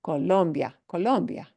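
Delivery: "Colombia" punched on "lom"; co-LOM-bia with "bia" as one syllable; second-to-last stress. Shown as a demonstration. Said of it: "what we punch is second to the last".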